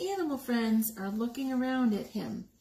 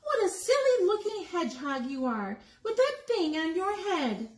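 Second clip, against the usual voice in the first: higher, sing-song cat taunt; teasing cat; 7 semitones higher than usual. Acted. Barn cat mocks Hedgie from the tree, higher teasing voice. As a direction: tease him from up the tree.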